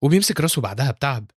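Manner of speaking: ordinary delivery, nothing marked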